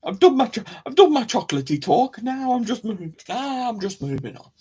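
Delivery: high, playful sing-song; silly high voice. Real, not acted. Joking self-mockery high voice.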